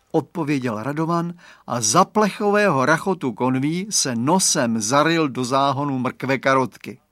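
plain reading voice

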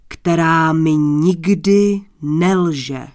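booming, solemn boast to a king; grandiose thunder-voiced prince. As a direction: build to a loud, slow, proud finish. Boastful stranger addresses the king.

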